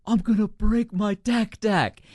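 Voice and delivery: high, loud, desperate yell; retold yell. Upset camper shouting at the group, high and loud yell. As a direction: yell it out like a kid at breaking point. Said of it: "and he yells".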